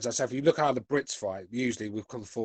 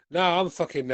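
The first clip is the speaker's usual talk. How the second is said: usual speaking voice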